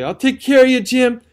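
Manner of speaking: high, loud frantic promise; hysterical cry. A narrator's reading. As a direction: cry it out, near breaking. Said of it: "cried out to him hysterically".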